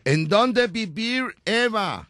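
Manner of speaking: put-on slow, high gringo-accented question; mock English. Host asking Eva where she lives, mock English accent. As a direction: stretch the Spanish into fake American English. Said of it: "otra pregunta en inglés".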